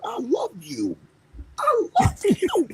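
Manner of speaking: mock promo. Retells the wrestler's over-the-top promo.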